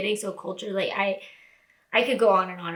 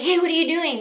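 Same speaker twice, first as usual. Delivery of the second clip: bright, higher call on the phone; imitating his cheerful hello; happy greeting; 7 semitones higher, 4 dB louder, faster than usual. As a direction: friend calling, sounding happy. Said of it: "just happy".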